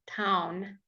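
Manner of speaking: Town whispered, the N held. Shown as a demonstration. Teacher to Spanish learners, a whispered Town. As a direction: breathe Town out, sound the N. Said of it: "do say the N".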